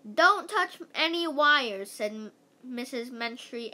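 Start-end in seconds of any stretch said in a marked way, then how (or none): 0.1-1.9 raised, higher urgent warning. A narrator's reading. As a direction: call out a caution. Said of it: "Andrea warned her".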